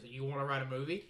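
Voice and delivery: normal talking voice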